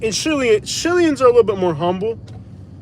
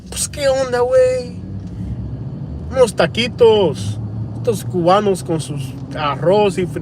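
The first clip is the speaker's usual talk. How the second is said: put-on Mexican Spanish accent; 2 semitones higher than usual. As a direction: stretch it out in an exaggerated Mexican sing-song. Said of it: "the Mexican guy's like".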